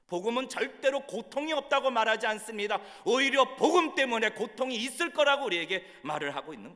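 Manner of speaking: high, loud, impassioned sermon climax; full-force preaching. Acted. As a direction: drive it out to the whole congregation at full voice. (높고 우렁차게 외치는 열정적인 설교)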